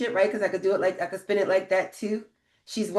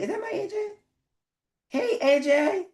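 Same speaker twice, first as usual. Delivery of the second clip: happy surprise; 11 semitones higher than usual. Real, not acted. Greeting a friend in the chat.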